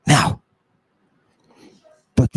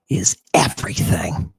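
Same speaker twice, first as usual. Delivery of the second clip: whispered for dramatic weight; hushed; 11 semitones lower, 6 dB quieter than usual. Real, not acted. Teacher driving the point home to the audience, hushed. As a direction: drop into a low, soft whisper.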